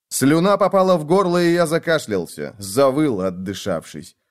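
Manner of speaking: ordinary delivery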